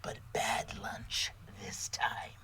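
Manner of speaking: slow, whispered menacing lunch lady; creepy lunch lady. Acted. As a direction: whisper it like a sinister ghoul. Monster lunch lady speaks.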